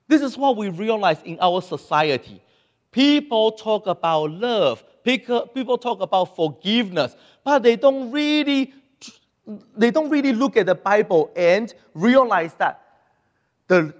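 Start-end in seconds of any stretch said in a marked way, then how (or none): none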